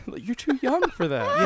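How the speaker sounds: laughing mock reply. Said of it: "You're like".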